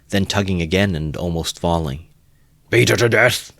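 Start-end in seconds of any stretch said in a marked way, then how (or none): 2.7-3.5 loud, higher savage shout; furious cry. Acted. Mikulka urging the beating, furious cry. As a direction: scream for blood. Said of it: "cried Mikulka".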